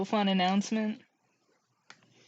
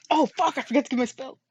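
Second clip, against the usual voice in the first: usual speaking voice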